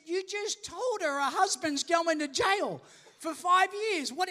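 high, acted disbelief; incredulous protest. Friend confronts the lawyer over her remark, incredulous. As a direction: challenge it in astonished, stretched words. Storyteller acts shocked challenge to lawyer.

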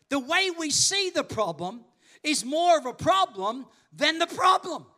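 emphatic, punchy pulpit delivery; preaching. Real, not acted. Preacher drives home a point to the congregation, preaching. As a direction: drive each phrase home, slow on key words.

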